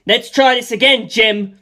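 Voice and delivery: loud taunting call; a loud challenge shouted across the sky; yelled challenge. A narrator's reading. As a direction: yell it at the enemy pilot.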